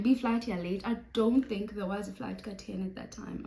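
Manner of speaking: usual speaking voice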